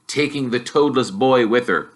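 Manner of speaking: ordinary delivery, nothing marked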